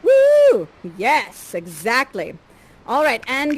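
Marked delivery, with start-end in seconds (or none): 0.0-0.7 loud, high "woo"; one big cheering whoop. Acted. Cheering the prince's stylish hat, high whoop.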